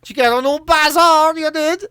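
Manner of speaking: high-pitched, hammed-up Cork accent; mock Cork accent. Portuguese lad imagined chatting round Cork, mock Cork accent. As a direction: lay on a high Cork singsong. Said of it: "walking around Cork going".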